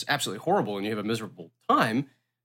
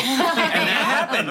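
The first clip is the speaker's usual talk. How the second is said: high, slow laughing reply; 12 semitones higher, 4 dB louder, much slower than usual. Real, not acted. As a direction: laugh it out, amused. Laughing at the memory of being killed.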